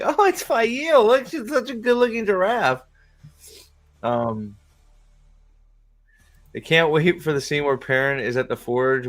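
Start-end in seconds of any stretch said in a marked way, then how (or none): 0.0-2.8 high falsetto cooing; hammed-up high-pitched admiration; mock gushing fans. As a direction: parody a delighted shill in a squeaky voice. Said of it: "they'll be like".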